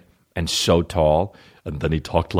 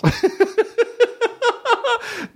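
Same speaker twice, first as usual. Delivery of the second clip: high-pitched burst of laughter; high shrieking laugh. Real, not acted. Cracking up at his own fake campaign ad, shrieking.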